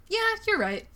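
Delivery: high, chirpy mock agreement; breezy high-pitched brush-off; lightly voiced carefree Bella. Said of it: "she's just gonna be like".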